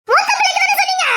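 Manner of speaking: high, shouted outrage; angry shout. Acted. Cheated woman shouting at her in-laws, outraged. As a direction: cry out in betrayed anger.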